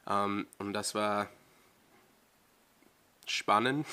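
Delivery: German with a slightly changed voice; German accent, lightly mimicking how it sounds; careful German pronunciation. Real, not acted. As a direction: speak German as natively as you can, feeling for words. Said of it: "my voice changes when I speak German".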